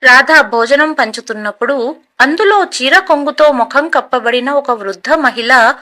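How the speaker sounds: plain reading voice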